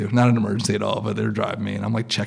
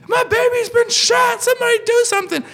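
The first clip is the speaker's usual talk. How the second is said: very high imitation of a screaming woman; mock frantic scream; 4 dB louder than usual. Woman behind her shot loved one, mock frantic scream. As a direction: shriek for help like a desperate mother, hammed-up.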